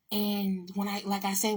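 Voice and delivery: normal talking voice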